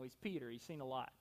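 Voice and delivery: ordinary talk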